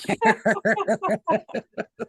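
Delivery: long, high peal of laughter; laughing. Real, not acted. Actress picturing breeze through costume, long high laugh.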